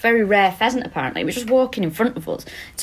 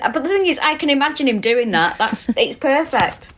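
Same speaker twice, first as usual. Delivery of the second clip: ordinary talk, nothing marked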